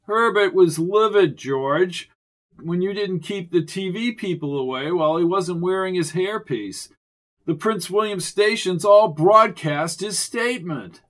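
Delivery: loud, snooty official voice. A narrator's reading. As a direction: talk down to them, loudly. Officious Chance lecturing.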